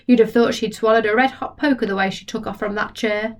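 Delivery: plain reading voice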